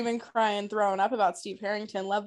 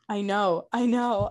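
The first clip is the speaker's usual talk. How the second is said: usual speaking voice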